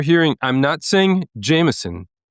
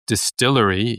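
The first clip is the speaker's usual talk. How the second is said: ordinary talk, nothing marked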